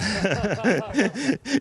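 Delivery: brief high giggle; short high laugh. Real, not acted. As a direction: laugh lightly in surprise. Commander laughs mid-speech.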